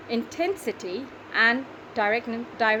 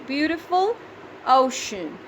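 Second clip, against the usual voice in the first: syllable by syllable; 3 semitones higher than usual. Shown as a demonstration. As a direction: set out "Beautiful ocean" beat by beat for counting. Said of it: "three syllables".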